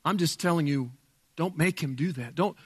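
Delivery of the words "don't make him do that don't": usual speaking voice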